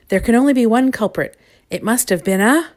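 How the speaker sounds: emphatic character voice, interrupted at the end; indignant accusation. A narrator's reading. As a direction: declare it loudly, rising to a cut-off. Indignant accusation cut off mid-sentence.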